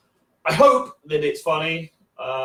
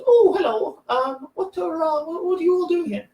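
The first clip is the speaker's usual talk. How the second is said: put-on very high, polite old-lady voice; little old dear; 13 semitones higher than usual. Little old lady asking the metal queue what they're doing, high old-dear voice. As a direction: put on a sweet old dear's high voice, hesitant. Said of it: "this little old dear".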